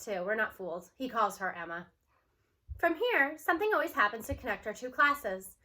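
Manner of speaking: usual speaking voice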